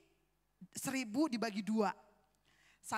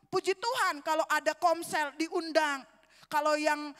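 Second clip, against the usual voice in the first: normal talking voice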